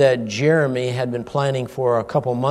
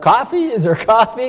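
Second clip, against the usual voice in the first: eager; 8 semitones higher than usual. Retold or imitated. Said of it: "boy, his eyes light up".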